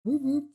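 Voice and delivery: high-pitched whoop-whoop like a squad car siren; siren chirp imitation.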